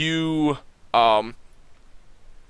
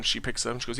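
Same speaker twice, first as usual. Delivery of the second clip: ordinary talk, nothing marked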